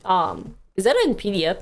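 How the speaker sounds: ordinary talk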